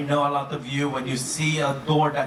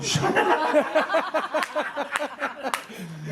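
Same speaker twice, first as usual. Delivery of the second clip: high sheepish laugh; 11 semitones higher than usual. Real, not acted. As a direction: laugh at the mess. Laughs showing own messy cabin.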